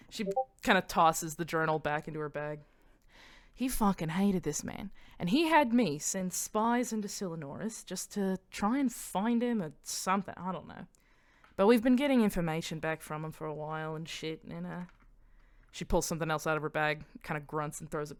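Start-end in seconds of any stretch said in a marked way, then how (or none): 3.5-14.8 gossipy Australian character voice; Australian accent. Acted. Mania gossiping about the Old King, Australian accent. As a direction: draw it out in Australian. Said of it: "that she turned Australian".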